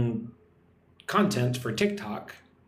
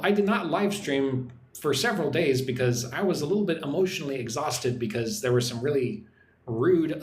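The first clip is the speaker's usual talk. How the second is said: normal talking voice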